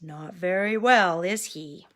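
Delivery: ordinary delivery, nothing marked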